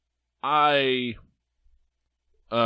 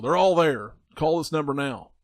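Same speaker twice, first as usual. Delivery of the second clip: infomercial voice; 4 semitones higher, 3 dB louder than usual. Pretend. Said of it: "They sound like an infomercial".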